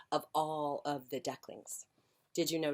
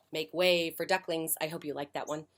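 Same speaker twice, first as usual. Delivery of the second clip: plain reading voice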